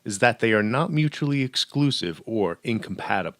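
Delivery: usual speaking voice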